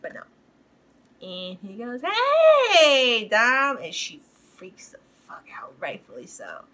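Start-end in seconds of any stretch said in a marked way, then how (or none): 2.0-3.8 high, loud cheery-greeting call, imitated. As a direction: call out as if hailing someone unexpectedly. Character calls out to Dom.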